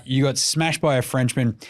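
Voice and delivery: usual speaking voice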